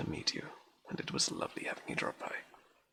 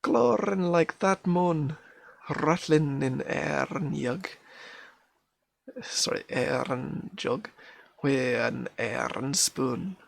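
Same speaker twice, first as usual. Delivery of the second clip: loud, raised Scots recital; Scots accent; 7 semitones higher, 10 dB louder, much slower than usual. Acted. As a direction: belt out the rhyme in a Scottish accent. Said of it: "this is gonna be an accent".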